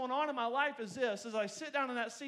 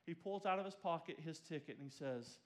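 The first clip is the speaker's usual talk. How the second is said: quiet, tender storytelling; tender retelling; 5 semitones lower, 6 dB quieter than usual. Acted. Preacher softly recalling his leader's gift, tender. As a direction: soften as you tell the gift.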